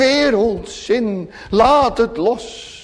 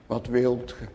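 much lower and softer, gentle asking; hushed tender question; 8 semitones lower, 12 dB quieter than usual. Retold or imitated. Preacher voicing the Lord's question to Bartimaeus, hushed and gentle. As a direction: ask it as if bending close to a blind man. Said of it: "aan je ziel vraagt".

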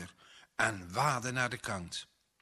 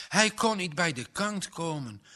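usual speaking voice

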